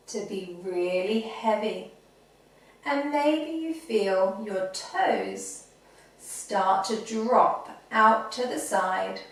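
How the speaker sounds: soft, low, soothing relaxation voice; guided meditation. Acted. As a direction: ease children into calm with long pauses between phrases. Leads children's body relaxation.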